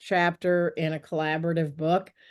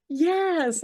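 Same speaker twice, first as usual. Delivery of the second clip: high, bright confirmation; eager yes; 10 semitones higher than usual. Retold or imitated. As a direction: chirp it out happily.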